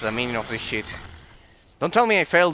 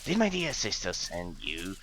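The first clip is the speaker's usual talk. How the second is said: creepy whispery spider; 6 semitones lower, 6 dB quieter than usual. Acted. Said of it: "that's kind of like the lizard voice".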